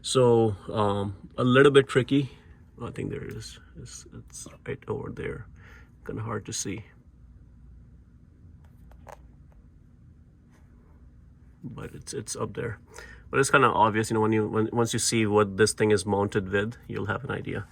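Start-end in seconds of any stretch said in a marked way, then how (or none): 2.8-12.8 hushed whisper under the car. Real, not acted. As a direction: drop to a breathy whisper. Whispering while working under car.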